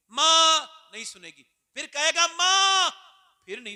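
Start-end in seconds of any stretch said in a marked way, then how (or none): 0.1-1.4 loud. Acted. 2.3-2.9 high, loud cry of "मां"; desperate call. Acted. Son begging his mother at judgment with a desperate cry.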